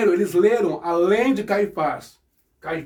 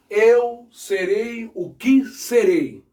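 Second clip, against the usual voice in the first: eu serei o que serei drawn out slowly and firmly; solemn emphatic rendering; slow, stressed correct translation; 3 semitones higher, 3 dB louder, much slower than usual. Shown as a demonstration. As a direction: lay out eu serei o que serei word by word. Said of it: "É no futuro".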